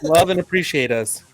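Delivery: normal talking voice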